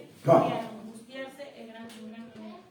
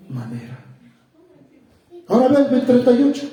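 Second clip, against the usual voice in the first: ordinary talk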